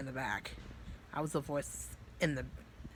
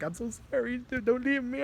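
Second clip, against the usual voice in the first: much higher, louder desperate cry; emotional plea; 10 semitones higher, 6 dB louder than usual. Retold or imitated. At the hospital bed, begging his friend in a high desperate cry. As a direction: beg him not to go, voice straining up. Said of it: "I was just getting so emotional".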